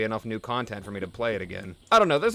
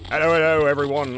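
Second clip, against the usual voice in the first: loud, hammed-up greeting with a really bad accent; YouTuber impression; 2 semitones higher, 7 dB louder than usual. Parodying a YouTuber greeting his viewers, in a loud bad accent. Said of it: "Like a cross between, like, Total Biscuit, but with a really bad accent".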